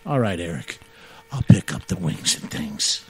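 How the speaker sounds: lightly voiced James agreeing to buy the wings; imitating James. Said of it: "And he".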